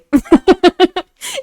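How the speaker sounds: high, loud burst of laughter; real laugh pitched well above the speaking voice. Real, not acted. Reader cracking up at their own aside, high loud laugh.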